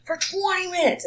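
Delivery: high, slow, whining complaint; mock an impatient customer; whiny customer. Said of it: "the person behind them is like".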